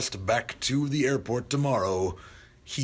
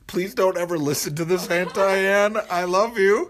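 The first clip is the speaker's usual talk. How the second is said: loud pleading; 9 semitones higher, 6 dB louder than usual. Acted. Pleading to his aunt.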